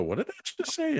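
high-pitched incredulous question; mock-baffled squeak; retold bewilderment. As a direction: shoot way up in disbelief. Retold puzzled reaction to the device.

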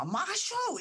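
high, sharp Massachusetts yell; yelling in New Bedford accent. Retold or imitated. New Bedford mom yelling at teens, in her thick accent. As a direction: yell it like a local mom scolding kids. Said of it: "she would just yell at us".

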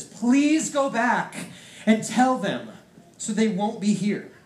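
high, loud and very slow pleading; begging rich man. Acted. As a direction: plead desperately from torment. Rich ruler begging from hell.

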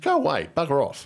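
rude dismissal. Retold or imitated. Retold brushing off beggar.

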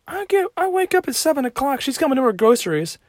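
high outraged voice about his mom shopping; shrill exasperation. Real, not acted. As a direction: squeal with disbelief at his mom. Mom went out anyway.

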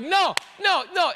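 high, loud mock despair; a hammed-up groan of no; Mordecai in dismay. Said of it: "And Mordecai's like".

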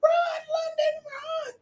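high-pitched screaming cheer, voice cracking; aunt screaming her niece down the track; retold yelling. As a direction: shriek for her niece to run. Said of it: "They definitely know".

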